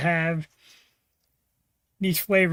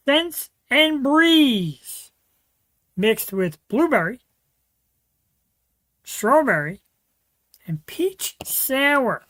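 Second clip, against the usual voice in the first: slow announcer read; 4 semitones higher, 4 dB louder, slower than usual. Acted. Drawn-out beer name announcement.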